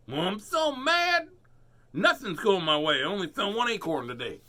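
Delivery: high, loud squeaky squirrel; high, loud cross squirrel voice; mad squirrel. Acted. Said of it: "Squirrel did not look happy".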